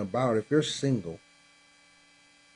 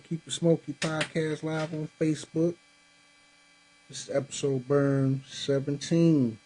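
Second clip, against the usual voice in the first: higher, upbeat podcast opener; show host intro; 8 semitones higher than usual. Acted. Host welcoming viewers to his cigar podcast, pitched-up show-host energy. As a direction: hype up the live audience like a show host.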